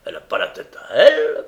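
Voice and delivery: very high, loud, quick outburst; angry cry. A narrator's reading. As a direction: cry it out, pitch leaping up. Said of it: "cria Sykes".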